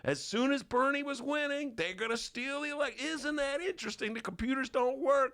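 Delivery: high, agitated rant; paranoid Bernie Bro. Retold or imitated.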